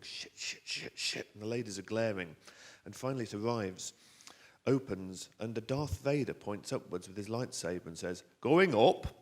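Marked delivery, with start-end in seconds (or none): 0.0-1.3 unvoiced repeated muttering; whispery, compulsive mumble; mumbled cursing. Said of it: "I realise I've been mumbling". 8.4-9.2 loud, high Yorkshire lift call; Yorkshire-accented Darth Vader. Acted. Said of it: "in a Yorkshire accent".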